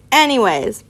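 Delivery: ordinary delivery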